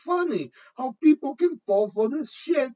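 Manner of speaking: high-pitched, incredulous and amused; laughing. Real, not acted. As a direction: squeal it out through helpless amusement. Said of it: "I'm sorry to be laughing".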